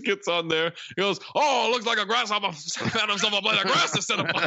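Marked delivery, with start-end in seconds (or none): 1.3-4.4 over-the-top cartoon accent, laughing through it; Foghorn Leghorn. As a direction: ham up a terrible drawling rooster accent, higher pitched. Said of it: "He sounds like fucking Foghorn Leghorn".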